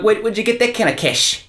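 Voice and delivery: high-pitched disbelief; incredulous questioning. Acted. Crime boss to his runner, incredulous. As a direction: act out a baffled boss demanding an explanation.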